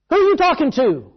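loud, high demanding question; a dad alarmed at his son; stern father. Retold or imitated. Father demanding to know who his son is talking to, loud. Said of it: "the dad came out and says".

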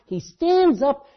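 ordinary talk, nothing marked

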